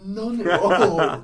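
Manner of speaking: loud, slow, drawn-out; loud and slow for emphasis; emphatic. Real, not acted. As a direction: hammer each word home. Stressing Scots have no humor.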